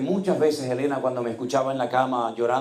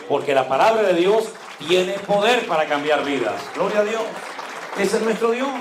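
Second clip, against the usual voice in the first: preaching climax; 7 semitones higher, 4 dB louder than usual. Real, not acted. Preacher rising to exclamation.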